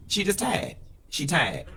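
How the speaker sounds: high, drawn-out Miami accent on "tired"; sassy Miami accent, rising on "tired". Shown as a demonstration. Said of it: "In my best Miami voice".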